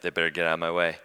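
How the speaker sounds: muted, low-pitched irritation; retold grumble. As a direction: grumble it under your voice at the other drivers. Retold irritation at drivers in traffic.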